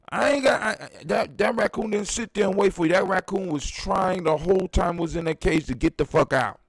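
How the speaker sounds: normal talking voice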